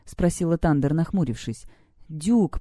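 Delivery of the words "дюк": usual speaking voice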